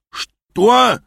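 high, loud, incredulous yelp; outraged yell. A narrator's reading. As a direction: yell it in disbelief, pitch shooting up. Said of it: "завопил Аас".

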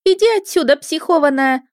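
put-on high, loud girlish scolding; displeased girl. A narrator's reading. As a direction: shoo someone off in a ringing girl's voice. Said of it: "Девичий голос звенел недовольством". (недовольный звонкий девичий голос)

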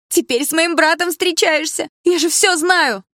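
very high, loud, slow screaming; hysterical shout. Acted. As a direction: shriek it accusingly. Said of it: "явно сходя с ума".

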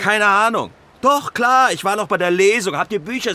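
loud, defensive retort. Acted. Author to his lover, answering her accusation with a loud defensive retort.

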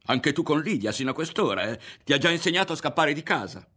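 high, loud furious scolding; angry accusation. A narrator's reading. Mother scolding her son over Lidia, angry accusation. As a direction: lay into him, pitched high and forceful.

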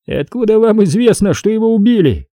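high, needling cop voice; mocking detective, high voice; sly cop Ramos. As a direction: poke fun at the suspect, pitched high.

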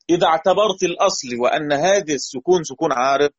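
ordinary talk

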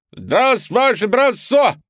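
loud, high barked chorus; barking chorus. Acted. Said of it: "Стараясь басить, рявкает класс".

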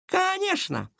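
very high, drawn-out sarcasm; chuckling scoff. A narrator's reading. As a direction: stretch it high in mock agreement. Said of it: "хохотнул Долгов". (с издевательской усмешкой)

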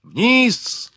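high, urgent cry; shouted order. A narrator's reading. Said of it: "закричал Ликен".

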